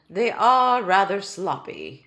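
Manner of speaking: loud, slow and stately; grudging admission. Acted. Tiny king admitting to Ellie his babies are messy, slow and grudging. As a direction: concede it reluctantly, like a proud king. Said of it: "he admitted finally".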